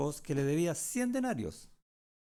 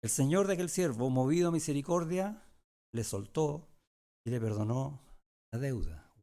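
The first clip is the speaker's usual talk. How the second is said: scripture reading; 3 dB louder than usual. Acted. Pastor reads Matthew's parable to the congregation, a raised, deliberate reading. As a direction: read the verses out to the flock.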